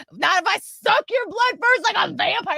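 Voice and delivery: high-pitched mock yell; imitating shouting girl. Girl threatening friend in theater, mock shouting. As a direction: squeal it out like a rowdy kid. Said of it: "like, shouting this".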